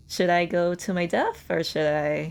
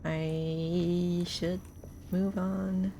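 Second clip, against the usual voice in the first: ordinary talk, nothing marked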